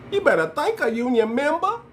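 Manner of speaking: high-pitched put-on voice, rising at the end; high mimicry of a politician's put-on talk; mocking Kamala. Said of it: "We don't talk like that".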